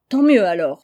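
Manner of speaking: loud, firm exclamation; exclaiming with conviction. A narrator's reading. Jack exclaims to Nell, relieved there is hope, loud and certain. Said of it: "s'écria Jack avec conviction".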